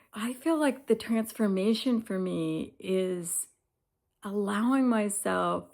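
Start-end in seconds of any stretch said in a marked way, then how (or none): none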